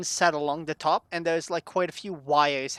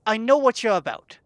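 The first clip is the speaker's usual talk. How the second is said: raised, quick scolding; irate barkeep interrogating; angry accusation; 6 semitones higher, 5 dB louder than usual. Acted. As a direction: lay into a known thief, higher-pitched.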